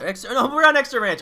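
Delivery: loud, fast, high self-correction; excited correction. Real, not acted. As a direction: blurt out the show's name. Catching own slip, loud.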